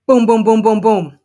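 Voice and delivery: full-force pounding. A narrator's reading. Sue pounds on Alice's door, loud forceful booms. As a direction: hammer out each boom like a fist on wood. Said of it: "And again".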